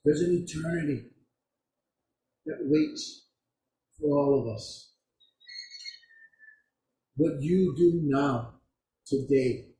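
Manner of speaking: low, soft, weighty pronouncement; grave, quiet preaching with long pauses; solemn, hushed. Real, not acted. Preacher delivers the story's grave point.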